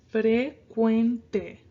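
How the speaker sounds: slow "frecuente" stressed on cuen; careful, slowed "frecuente" demo. Shown as a demonstration. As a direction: draw out fre-CUEN-te slowly. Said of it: "our emphasis is gonna be on this quen".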